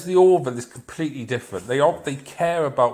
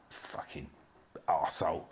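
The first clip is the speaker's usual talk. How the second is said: very quiet, half-whispered aside; muttered insult; 8 semitones lower, 13 dB quieter than usual. Real, not acted. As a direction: swear under your breath. Muttered insult after rant aside.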